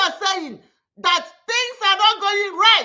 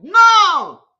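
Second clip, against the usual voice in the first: sharp shouted no; 10 dB louder than usual. Real, not acted. Rejecting calls to riot.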